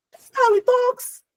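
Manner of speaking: high, drawn-out mock excuse. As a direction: repeat it high and slow, mocking the excuse. Mocking a woman's excuse, repeated.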